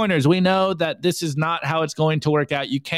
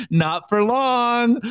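higher, louder, slow menacing line; slow, higher, unhinged warning; crazy-eyed menace; 6 semitones higher, 3 dB louder, much slower than usual. Retold or imitated. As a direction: drag it out with a crazed threat. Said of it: "crazy-eyed look over here".

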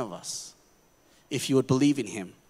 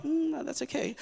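mock casual shrug; 10 semitones higher, 6 dB quieter than usual. Mocking indifferent response.

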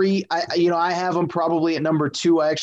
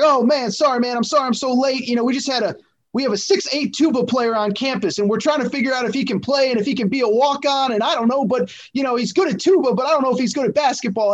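higher-pitched chatty coach voice with a bad Texas twang; Scott Drew impression; 6 semitones higher than usual. Host imitating the coach apologizing late on the phone, higher chatty coach voice. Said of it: "your Scott Drew impression is not bad".

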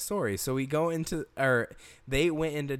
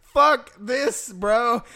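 loud, high, drawn-out protest; slow it down and refuse loudly; emphatic retold refusal; 9 semitones higher, 9 dB louder, much slower than usual. Storyteller refusing to hunt snakes, loud exasperated protest.

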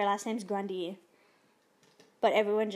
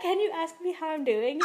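fangirl asking; 5 semitones higher than usual. Retold or imitated. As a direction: blurt it out like a starstruck fan. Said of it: "she's, like, fangirling over him".

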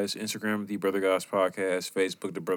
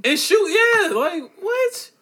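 very high loud disbelief; incredulous; 10 dB louder, much slower than usual. Real, not acted. Reacting to a massacre story, high incredulous cry. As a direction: squeal in disbelief.

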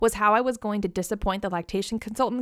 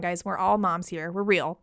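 ordinary talk, nothing marked

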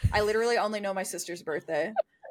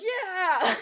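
high mock cheer of agreement; hyped-up high yeah from the background; friend chiming in; 10 semitones higher, 3 dB louder than usual. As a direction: chime in from behind, high and eager. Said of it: "Kennedy will be in the background behind me like".

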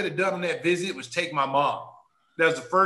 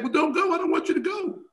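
put-on pleading girlfriend; 12 semitones higher than usual. Girlfriend pleading, voiced high.

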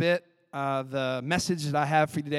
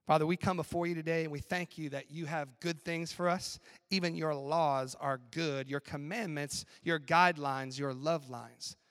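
prayer; 5 dB quieter than usual. Acted. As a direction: pray gently over the room. Closing prayer.

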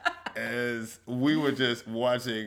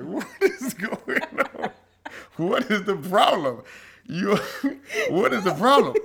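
exasperated mock complaint; 8 semitones higher, 3 dB louder, slower than usual. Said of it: "I'm like".

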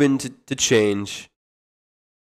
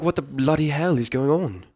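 softer put-on British accent; playful British accent; 5 dB quieter than usual. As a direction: echo it in mock British. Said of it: "doing the British accent".

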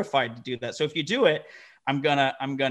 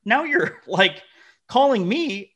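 higher and louder, mock exasperation at the client; exasperated retort; 10 semitones higher, 6 dB louder, slower than usual. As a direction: throw it back at him, fed up. Retelling argument with a client.

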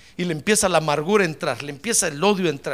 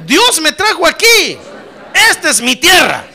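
loud, emphatic preacher's call for repetition; rousing declaration; 5 semitones higher, 9 dB louder than usual. Real, not acted. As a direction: lead the congregation phrase by phrase, forcefully. Leads congregation in shouted declaration. (declaración de fe enérgica, a viva voz)

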